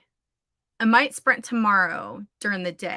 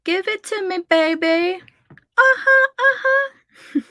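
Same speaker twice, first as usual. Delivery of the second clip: mock crying; 10 semitones higher, 7 dB louder than usual. Begging the app, mock crying.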